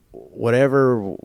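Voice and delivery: slow, drawn-out shrug of a word; a slow, fading whatever; resigned whatever. Real, not acted. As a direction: let it trail off, done with it. Dismissing the stolen car.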